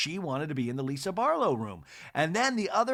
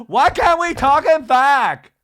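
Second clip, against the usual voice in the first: much higher, louder, slow mock scream; imitating Lisa's scream; 13 semitones higher, 15 dB louder, slower than usual. Imitating Lisa screaming at the women, mock scream. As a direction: shriek it, drawn out. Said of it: "she always screams".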